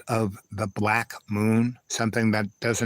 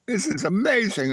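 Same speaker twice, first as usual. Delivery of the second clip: delighted; 8 semitones higher than usual. Retold or imitated. Acts out delight at food.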